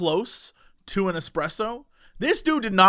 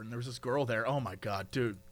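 normal talking voice

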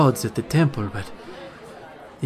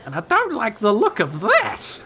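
put-on high old woman's voice; a grumpy old lady, pitched high; elderly woman; 9 semitones higher than usual. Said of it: "looks at Plague and says".

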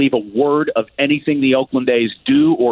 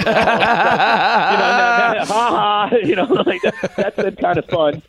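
high-pitched, amused talk through laughter; laughing; 9 semitones higher, much slower than usual. Real, not acted. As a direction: laugh your way through the words. Laughing after own fan imitation.

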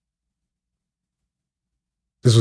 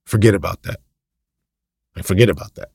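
normal talking voice

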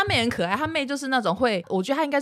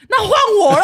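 higher, louder, laughing through it; mock turn-taking; 12 semitones higher, 10 dB louder, faster than usual. Wife imitating husband claiming his turn to yell, laughing. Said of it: "我老公还会先说".

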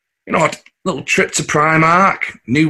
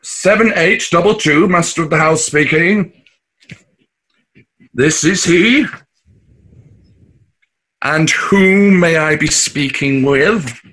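put-on plummy telephone manner, higher and slowed right down; posh phone voice; 3 semitones higher, slower than usual. Dad answering the lottery call in a put-on posh voice. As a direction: play the master of the house for the caller. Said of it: "Why is Dad talking like a stuck-up tw-, I mean posh person".

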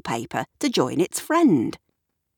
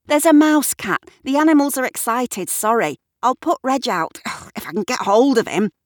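raised, higher-pitched hurried explanation; flustered; 7 semitones higher, 4 dB louder, faster than usual. A narrator's reading. Explaining the animal chaos to Kat, flustered and loud. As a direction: call out apologetically while busy wrangling.

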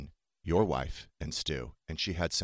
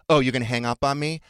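louder, higher, affronted question; retold indignant challenge; 6 semitones higher, 7 dB louder than usual. Imitating dad as the call is ending, loud indignant challenge. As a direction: throw it back as a raised challenge. Acting out dad's indignant reply.

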